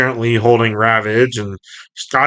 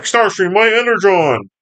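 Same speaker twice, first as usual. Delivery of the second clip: high-pitched pleading robot cry; Skywarp; 8 semitones higher than usual. Retold or imitated. Skywarp calls to Starscream about his Energon, high and urgent. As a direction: call out in a raised, urgent cartoon voice. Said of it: "Skywarp's like".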